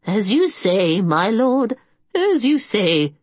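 high, slow, defeated keeper's voice; grudging old caretaker. A narrator's reading. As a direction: give in reluctantly to your lord. Agamon yields to the king.

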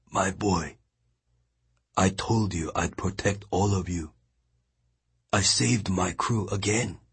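put-on a dazed man comforting his boy; old captain, faint. A narrator's reading. Dazed old captain softly reassuring his third mate.

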